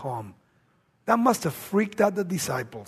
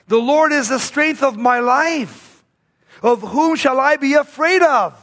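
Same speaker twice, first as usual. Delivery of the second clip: proclaiming scripture; 5 semitones higher, 4 dB louder than usual. Acted. Preacher proclaims psalm.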